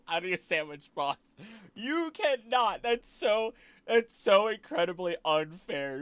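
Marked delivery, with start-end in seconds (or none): 1.8-6.0 higher, slow drawn-out protest; mock indignation. Real, not acted. Streamer complaining about a sandwich boss, slow and indignant. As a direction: drag out the unfairness in mock outrage.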